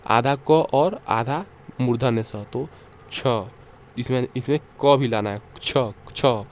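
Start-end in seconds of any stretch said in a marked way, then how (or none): 3.1-3.3 छ said as क्ष, a clear k before the sh sound; छ with the half क joined in front; pron demo. Shown as a demonstration. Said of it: "ऐसे बोलना है". 5.6-6.4 छ, छ each said as क्ष, a clear k onto the sh; छ, छ slowly with the क sound in front; pron demo. Shown as a demonstration. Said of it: "ऐसे बोलना है".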